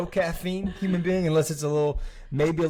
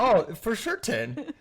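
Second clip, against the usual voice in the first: playful teasing; 8 semitones higher, slower than usual. Pretend. Joking rating of spouse.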